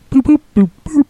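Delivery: high, bright beeping boops, voiced like a machine; mock upload beeps. Host makes beeps while loading the voicemail, high and bouncy. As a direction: pretend computer noises while uploading.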